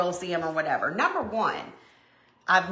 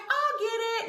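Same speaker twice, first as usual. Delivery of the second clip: a hint of a high little boy, offhand; just a touch of a kid's voice, casual; lightly voiced nephew; 13 semitones higher than usual. Nephew offering to grab the cicada, lightly high and casual. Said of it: "He's like".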